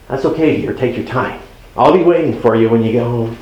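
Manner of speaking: easy now, no blame in it; gentle reassurance. Retold or imitated. Spouse gently answers lateness call.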